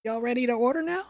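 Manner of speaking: bright, fast Southern waitress voice; diner waitress. Acted. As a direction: sing it out like a busy diner waitress.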